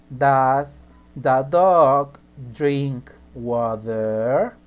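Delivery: drawn out very slowly, word by word; slow model sentence. Shown as a demonstration. Teacher modelling the question slowly for students. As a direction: lay the sentence out slowly so they follow. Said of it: "Entonces será".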